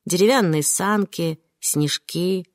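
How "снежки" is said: ordinary delivery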